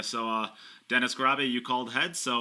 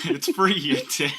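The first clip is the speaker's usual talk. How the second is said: laughing; 5 semitones higher, 7 dB louder than usual. Real, not acted. Laughing mock insult banter.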